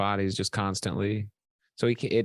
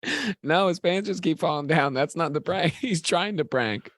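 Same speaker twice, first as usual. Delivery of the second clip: laughing; 6 semitones higher than usual. Real, not acted. Laughing through the bit.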